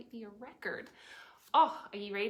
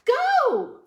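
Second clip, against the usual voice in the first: very loud high yell; shout; 18 dB louder than usual. Acted. Reader yelling go for the last round. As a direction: throw everything into it. Cheering the wrecking ball on.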